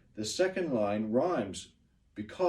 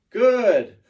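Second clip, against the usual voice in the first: big loud Good; excited teacher praise; 7 semitones higher, 11 dB louder than usual. Real, not acted. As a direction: cheer the class.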